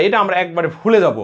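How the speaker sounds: ordinary talk, nothing marked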